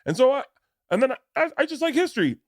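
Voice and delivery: very high, hammed-up contestant soundbite; mock show contestant. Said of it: "They'd freeze it mid sentence".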